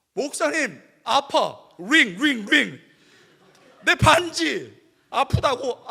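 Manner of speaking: loud, high annoyed whine; whiny complaint. Retold or imitated. Student complaining to pastor, loud annoyed whine. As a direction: whine that the ring hurts.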